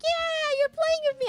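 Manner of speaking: very high, eager squeal of a playful dog; excited dog voice. Pretend. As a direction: squeal it like a dog thrilled to play. Voicing the chasing dog.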